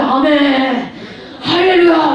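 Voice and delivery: drawn-out mock amen and hallelujah; mock testimony fervour. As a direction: imitate a congregation's hearty acclamation. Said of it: "그러잖아요".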